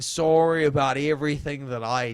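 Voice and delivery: an exaggerated, overly apologetic Canadian accent. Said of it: "give me a baseline for a Canadian accent".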